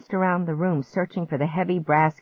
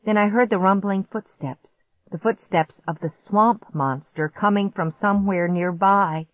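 ordinary delivery

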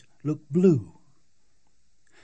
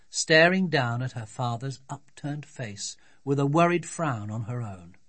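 ordinary delivery, nothing marked

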